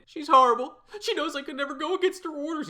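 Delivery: high, fast whining; whiny wail. Acted. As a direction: whimper like a scared boy. Whiny boy complains of sister.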